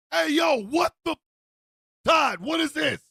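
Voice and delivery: loud, high shocked demand; appalled outburst. Acted. Mike confronting roommate Todd over his phone, appalled outburst. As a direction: burst out in horrified disbelief.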